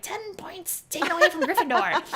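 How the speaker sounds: high, hammed-up penalty proclamation; mock points announcement. Coach joking about lost confidence points, mock proclamation.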